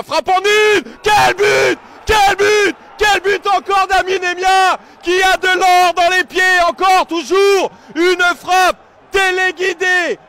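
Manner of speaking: loud, delighted shouting of the goal; excited sports commentary. Real, not acted. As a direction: roar it like the best goal of the season. Goal call in live commentary.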